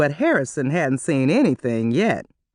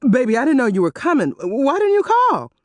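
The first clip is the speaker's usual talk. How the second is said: panicked shout; 8 semitones higher, 3 dB louder than usual. A narrator's reading. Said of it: "Harrison shouted".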